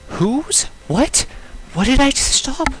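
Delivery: high, loud burst of questions; startled confusion. Real, not acted. Player confused by what just got stopped, startled outburst. Startled reaction to game event.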